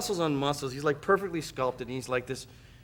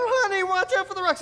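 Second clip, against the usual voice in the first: high-pitched worried warning; wife warning her husband about the rocks; fussing wife. Retold or imitated. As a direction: call out anxiously to him. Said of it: "Rob's going".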